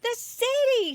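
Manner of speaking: high, loud, thrilled; light up at the idea; excited realisation. A narrator's reading. Katy suddenly gets the idea.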